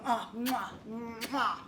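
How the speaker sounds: soft blown kisses; little kiss noises, fading away; between kisses. Acted. Blowing kisses goodbye at window.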